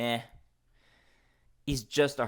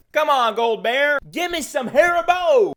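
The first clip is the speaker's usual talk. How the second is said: loud, much higher eager call; excited plea; 12 semitones higher, 10 dB louder than usual. Real, not acted. Vlogger calling to the gold bear mascot, loud and high.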